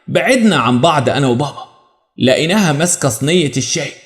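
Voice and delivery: ordinary delivery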